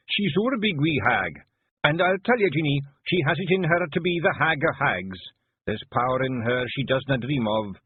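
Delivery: a proud Feegle talking up his hag; a hint of a fierce wee Scots warrior; Rob Anybody voice. A narrator's reading. Rob Anybody, gruff Feegle clan chief.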